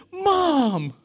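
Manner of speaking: very high happy call; a little boy recognizing his mom; joyful child's cry. Retold or imitated. Son calls out to his mother on seeing her, very high.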